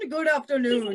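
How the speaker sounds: odd put-on silly voice; goofy voiced greeting. Shown as a demonstration. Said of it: "a silly voice".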